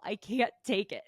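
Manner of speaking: normal talking voice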